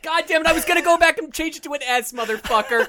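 loud, high exasperated rant; frustrated outburst. Real, not acted. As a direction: blow up at losing the point. Frustrated outburst over spelling.

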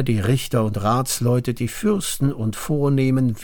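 plain reading voice

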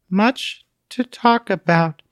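slow, dreamy and distant; sleep-talking. A narrator's reading. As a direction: murmur it as if half asleep. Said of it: "sounding as if speaking in her sleep".

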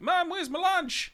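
put-on high child's voice calling mum; kid calling out. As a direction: call out like a whiny kid.

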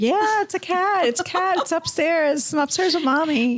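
high, bright, excited child imitation; excited kid voice. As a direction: gush like an excited little kid. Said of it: "They were actually excited".

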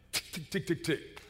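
quiet, quick ticking noises; soft staccato car clicks; imitated car ticking. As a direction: tick like an engine that won't start. Imitates car failing to start.

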